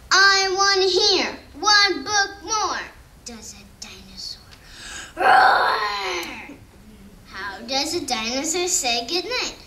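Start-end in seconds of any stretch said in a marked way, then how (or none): 0.1-2.8 loud, high demanding shout; dinosaur shouting. Acted. Reading to children, the little dinosaur shouts for one more book. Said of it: "floor and shout". 3.3-4.4 hushed, breathy build-up whisper; suspenseful whisper. Acted. Reading to children, whispering before the big roar. 5.1-6.6 loud, high playful roar; dinosaur roar. Acted. Reading a bedtime story to children, playful dinosaur roar.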